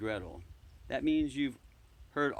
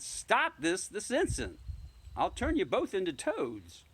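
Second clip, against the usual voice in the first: shouted; 8 semitones higher than usual. Acted. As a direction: shout at the children like an angry witch. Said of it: "I stomped my foot and shouted".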